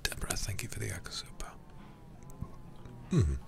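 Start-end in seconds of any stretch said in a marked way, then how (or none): 0.0-1.5 soft whispered thanks, much softer. Real, not acted. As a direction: breathe a quiet thank-you. Thanks for super chat.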